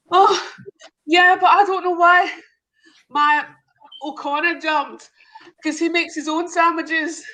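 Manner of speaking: a hammed-up tearful Irish wife. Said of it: "I tried to do the accents".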